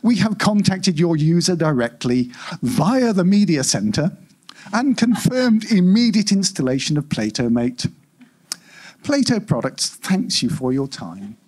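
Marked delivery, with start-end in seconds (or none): none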